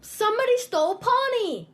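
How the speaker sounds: high-pitched indignant cry; yelling across the room. Acted. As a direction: call out in outrage. Child cries out about stolen duck.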